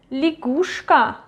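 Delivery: лягушка with я as i, stress on the u; reduced unstressed Ya. Shown as a demonstration. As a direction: say лягушка as li-GUSH-ka, carefully modelled. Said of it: "Stress is going to U".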